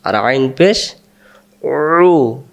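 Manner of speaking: ordinary delivery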